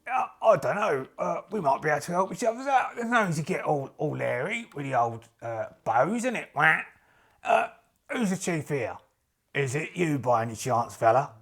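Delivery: wheeling-dealing geezer voice, swinging in pitch; matey adventurer. Acted. Adventurer asking who's the chief, matey geezer voice. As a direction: chat them up like a slangy chancer. Plays adventurer addressing merchant's party.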